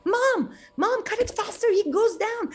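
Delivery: screaming. Acted. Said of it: "continues to scream".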